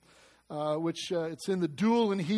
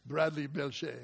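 mock French; 5 semitones lower, 7 dB quieter than usual. Shown as a demonstration. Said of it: "Doesn't that sound nice?".

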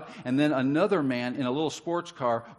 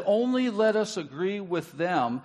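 slow, high emphatic reading; preaching; 4 semitones higher, 3 dB louder, slower than usual. Acted. Preacher exposing Hamor's greed to his church, slow and emphatic. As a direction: lean hard on the scheming words. Scheming speech read out.

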